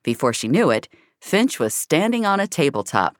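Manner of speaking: ordinary delivery, nothing marked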